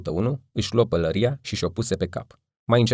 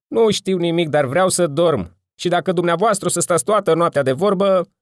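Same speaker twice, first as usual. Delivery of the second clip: loud, higher, irritated yell; annoyed shout; 10 semitones higher, 7 dB louder than usual. A narrator's reading. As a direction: snap back, wanting to sleep. Sleepy neighbour yells back.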